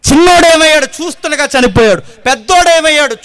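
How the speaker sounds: loud, high sermon cry to the congregation; loud pleading preacher; fiery preaching. Acted. Preaching at full force.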